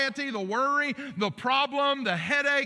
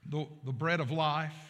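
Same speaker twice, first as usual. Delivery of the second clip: prayer; 5 semitones lower than usual. Acted. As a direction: pray it gently to the Father. Opening prayer before sermon.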